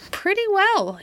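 discontented. A narrator's reading. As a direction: echo it with sulky discontent. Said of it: "in a discontented tone".

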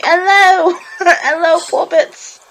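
high, hammed-up fake British greeting; bad British accent. Guest jokes with host in a noticeably bad British accent. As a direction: overdo a terrible British accent. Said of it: "'cause it wasn't noticeably bad".

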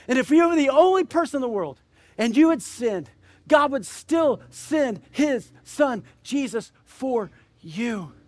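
preaching at full force. Acted. Preacher urging listeners toward faith, full-force preaching. Preacher's altar call climax.

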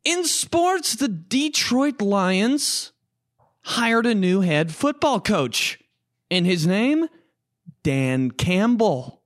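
high, bright sportscast read; news anchor voice. Pretend. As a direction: deliver it like a cheesy TV anchor.

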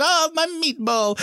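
high, slow, hammed-up Italian accent; mock Italian accent. Joking about the movie's Italian restaurant scene, in a mock Italian accent. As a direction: camp Italian accent stretched out. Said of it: "whenever you hear all the accents".

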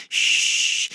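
unvoiced hush; shushing. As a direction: shush her urgently. Hushing for neighbours.

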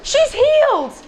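high, loud burst of joy; excited squeal. Acted. Narrator squealing as the healed woman. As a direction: squeal it out like a miracle just happened. Healing scene, excited exclaim.

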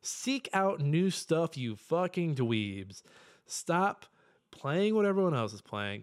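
slow declaration. Real, not acted. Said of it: "Here's what I'm gonna say".

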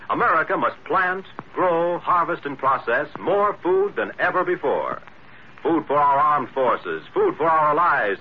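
ordinary delivery

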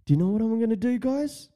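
eager schemer. Acted. Acted schemer addressing the boys.

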